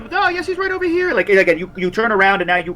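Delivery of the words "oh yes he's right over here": high-pitched character line; put-on high voice, as if overheard. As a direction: pipe up in a much higher voice.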